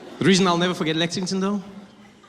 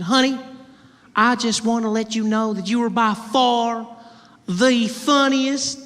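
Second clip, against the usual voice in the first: high, drawn-out Southern belle, louder; 9 semitones higher, 3 dB louder, slower than usual. Retold or imitated. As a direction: savour each word like a flirty belle. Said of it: "She said".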